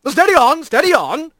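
high, genial reassurance; steady him with a grin in the voice; cheery skipper calming a casualty; grinning otter mayor. A narrator's reading. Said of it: "the large otter said with a grin".

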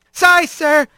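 high, hurried little apology; chastened. A narrator's reading. Rat answering the straw boss's yell, chastened. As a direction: pipe up meekly to the boss.

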